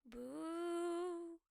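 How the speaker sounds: drawn-out ghostly boo; spooky ghost boo. Acted. Storyteller haunting the listener, ghostly moan.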